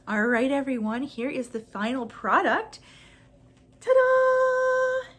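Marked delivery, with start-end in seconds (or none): 3.8-5.1 excited ta-da. Real, not acted. Reveals the final product.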